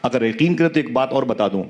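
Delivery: storytelling preacher, voice rising and falling with emotion; impassioned sermon. Acted. Preacher to the gathered congregation, impassioned storytelling. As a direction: tell it to move the congregation to tears.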